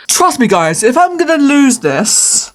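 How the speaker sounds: ordinary talk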